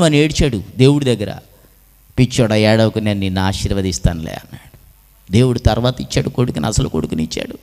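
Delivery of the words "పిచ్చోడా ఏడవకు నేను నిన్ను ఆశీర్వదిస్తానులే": low, soft indulgent reassurance; soothing. Acted. God comforts a weeping Abraham, low and soothing. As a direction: hush him kindly, voice dropped low.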